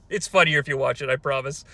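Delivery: ordinary delivery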